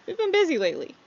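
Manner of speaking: put-on high, friendly old pal; friendly NPC voice. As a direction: greet him like a long-lost friend.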